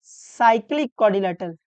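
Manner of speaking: drawn out for writing; slow dictation. Shown as a demonstration. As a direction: dictate the term slowly. Dictating the term to write.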